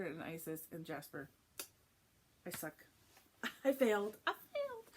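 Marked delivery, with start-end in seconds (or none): 2.5-4.2 loud, high laughing confession; laughing admission. Real, not acted. Owns up to forgetting names. 4.3-4.9 very high-pitched mock lament; comic squeal.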